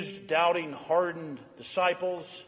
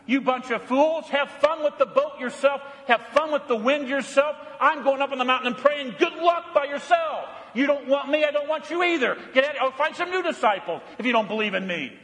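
higher, mock-angry telling-off of fools; exasperated rebuke; 6 semitones higher than usual. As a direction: play a fed-up master storming off. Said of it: "That's what you and I would have done".

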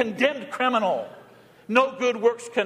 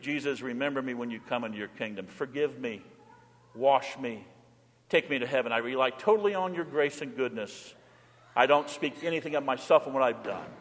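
quiet plea; 8 semitones lower, 5 dB quieter than usual. Pretend. Thief's plea for absolution.

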